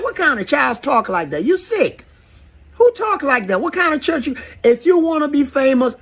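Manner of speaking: high-pitched incredulous outrage; appalled. Real, not acted. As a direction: pitch leaping up in real disbelief. Disgusted at the kid's quoted lyrics.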